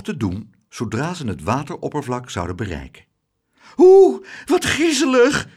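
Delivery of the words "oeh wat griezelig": very high, loud squeal; alarmed high-pitched whoop; frightened cry. A narrator's reading. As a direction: shriek in squeamish horror. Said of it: "riep juffrouw Klaterhoen".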